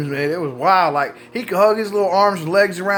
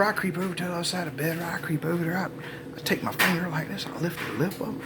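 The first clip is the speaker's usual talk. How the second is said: softer, like creeping past a sleeper; sneaky hushed telling; 9 dB quieter, faster than usual. Retold or imitated.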